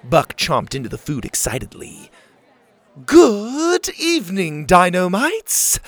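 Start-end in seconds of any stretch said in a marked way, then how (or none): 3.1-5.8 high, slow greeting to the whole camp; lightly voiced camp leader calling out. A narrator's reading. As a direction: welcome the crowd like a grand hostess. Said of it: "Ma called out".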